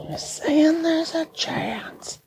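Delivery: breathy hushed movie quote; whisper. Pretend. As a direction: whisper the line back, slowly.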